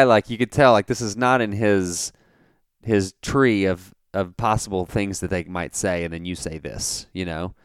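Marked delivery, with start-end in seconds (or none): none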